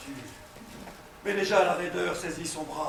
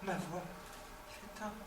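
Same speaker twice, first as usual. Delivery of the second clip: fading to a whisper; 2 semitones higher, 16 dB quieter than usual. Acted. Dying hero fading voice.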